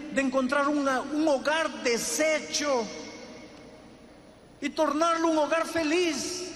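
high-pitched, soaring preacher's cry; rising call of a revival preacher; fervent preaching. Acted. Climactic preaching, rising appeal.

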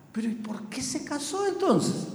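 high-pitched, incredulous retold question; astonished question. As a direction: act out baffled disbelief, pitch up without shouting. Pastor acts out his astonished question. (pregunta con asombro)

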